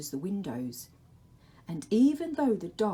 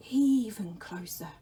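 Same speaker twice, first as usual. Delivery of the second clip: the Dark's whisper; 3 semitones higher, 3 dB louder than usual. Acted. The Dark calls to Laszlo in a whisper. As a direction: breathe his name from the shadows.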